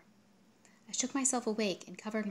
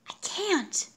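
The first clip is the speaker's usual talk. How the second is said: whispering; 11 semitones higher, 7 dB louder than usual. Acted. Whispered refusal at the door.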